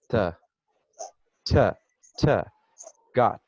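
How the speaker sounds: "T" and "Got" with the tongue up top, crisp t; English t in got. Shown as a demonstration. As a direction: end "Got" with a clean English t. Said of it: "minha língua termina aqui". ("Got" com T inglês bem marcado)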